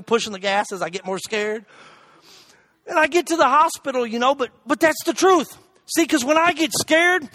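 ordinary talk, nothing marked

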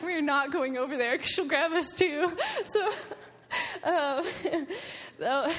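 high, laughing through the story; can't keep a straight face. Real, not acted. Telling the group about Banjo's grip, laughing.

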